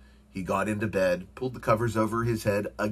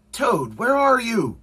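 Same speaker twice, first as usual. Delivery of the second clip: high, loud searching call; 13 semitones higher, 7 dB louder than usual. A narrator's reading. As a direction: call through the house for a friend. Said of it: "called Frog".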